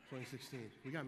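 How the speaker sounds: chuckling through the date; a real little laugh for his wife; laughing while saying the date to his wife. Real, not acted. Laughs teasing wife watching.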